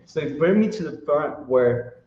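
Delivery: normal talking voice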